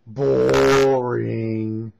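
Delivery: long drawn-out, droning jeer; mocking boredom. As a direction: drag the word right out on a level note.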